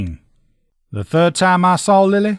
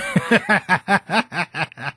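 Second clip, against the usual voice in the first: short amused laugh; 3 semitones higher, 3 dB quieter than usual. Acted. Amused after remark.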